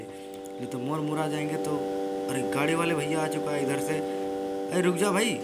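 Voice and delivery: normal talking voice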